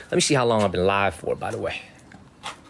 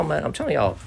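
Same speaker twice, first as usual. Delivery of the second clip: ordinary talk, nothing marked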